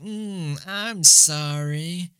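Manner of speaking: high, very slow cartoon mimicry; mock apology. Imitating South Park characters rubbing nipples, drawn-out mock apology. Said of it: "the other one where they're".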